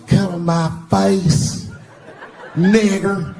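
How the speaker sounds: put-on slow, drawn-out girlfriend voice; girlfriend's demand. Girlfriend in bed telling him to finish, drawn out slowly. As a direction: drawl it out slowly as her. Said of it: "she looks at me and goes".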